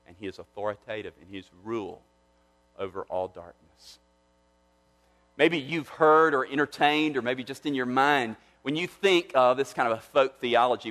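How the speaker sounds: normal talking voice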